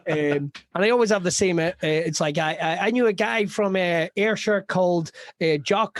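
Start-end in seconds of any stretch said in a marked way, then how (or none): none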